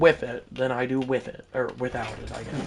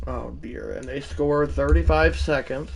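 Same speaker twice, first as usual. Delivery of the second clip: ordinary talk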